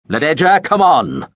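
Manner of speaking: loud, high, impatient call; booming out. Acted. Veppers booming for the hidden girl, loud impatient call. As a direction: boom her name out across the theatre. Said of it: "Veppers' voice boomed out".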